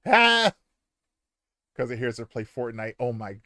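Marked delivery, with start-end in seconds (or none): 0.1-0.5 high, loud surprised shriek; real startled yelp. Real, not acted. Reacting to parrot.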